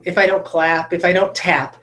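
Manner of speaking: ordinary talk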